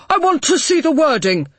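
angry, forced through clenched teeth; gritted teeth. A narrator's reading. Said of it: "said through gritted teeth".

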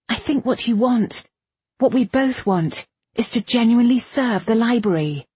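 high, urgent appeal; earnest Irene. A narrator's reading. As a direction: press for common ground.

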